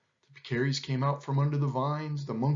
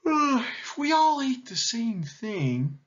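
slow, higher, stretched-out yawning words; 9 semitones higher, slower than usual. A narrator's reading. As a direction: talk through a big yawn. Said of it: "stretched his wings and yawned".

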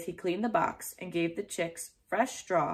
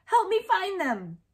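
high, loud plea for help; urgent shout; 12 semitones higher, 4 dB louder than usual. A narrator's reading. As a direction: call for help in alarm. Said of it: "shouted Daniel".

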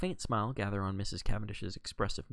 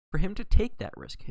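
louder, higher burst; a sudden raised emphatic phrase; 10 semitones higher, 8 dB louder than usual. Acted. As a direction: push it up with conviction. Hastings reasoning aloud, emphatic.

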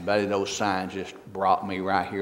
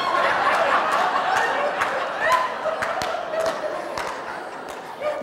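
long airy laugh; high breathy laugh. Real, not acted. Preacher laughing at his punchline, long airy laugh. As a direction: laugh hard at your own joke.

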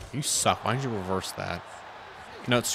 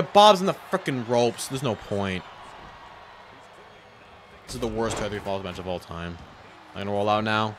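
ordinary talk

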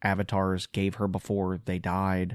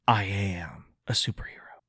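whispered mocking quote of the heroine; a hushed, mocking superhero claim; 4 dB quieter than usual. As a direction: breathe it out as a sneering parody. Mocking the heroine's cringe line.

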